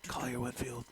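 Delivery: hushed golf announcer whisper; golf commentator voice. Acted. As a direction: murmur it like a golf broadcast. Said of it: "gonna start using my golf voice here".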